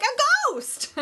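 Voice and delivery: put-on high, bright child's answer; high girl voice. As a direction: answer like a wide-eyed girl. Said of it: "She's like".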